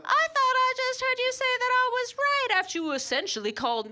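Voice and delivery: high, mock-sweet petty disbelief; petty wife. Wife to husband who doubted her, high petty sarcasm. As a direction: play dumb sweetly to twist the knife. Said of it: "I'd get real petty".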